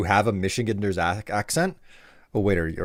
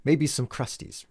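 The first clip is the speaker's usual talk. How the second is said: ordinary delivery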